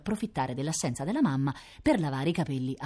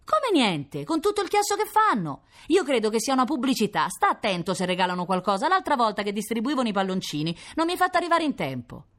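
put-on high, loud child's protest; indignant little girl; 8 semitones higher, 3 dB louder than usual. A narrator's reading. Little sister arguing from the bathroom, high indignant protest. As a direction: play a kid complaining from another room.

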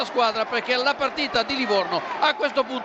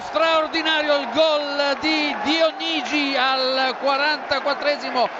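excited shout; 3 semitones higher than usual. Real, not acted. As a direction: shout it out with joy. Live goal call on radio.

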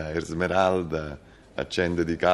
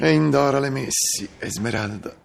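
poetic declaiming; 9 semitones higher than usual. Acted. Lofty poetic couple dialogue.

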